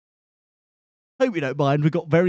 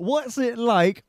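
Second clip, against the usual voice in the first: incredulous echo; 8 semitones higher, slower than usual. Real, not acted. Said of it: "What a leading question".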